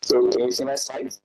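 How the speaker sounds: heavily compressed mic sound; compressed audio. Real, not acted. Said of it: "your audio is a bit compressed sounding".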